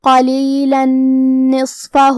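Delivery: قلیلا نصفہ joined, the tanween merged into the نون of نصفہ with a long nasal hum; very slow recited قلیلا نصفہ, doubled نون with nasal ghunna; idgham with ghunna. Shown as a demonstration. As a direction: chant قلیلا نصفہ slowly as one phrase, tanween vanishing into a held nasal نون. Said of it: "نون میں ملا کے غنہ اور تشدید کے ساتھ".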